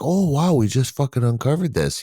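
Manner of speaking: high-pitched fake wonder at a discovery; mock amazement. Skeptic mimicking those announcing old news, mock amazed. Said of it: "So to say, like".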